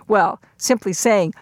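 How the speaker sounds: normal talking voice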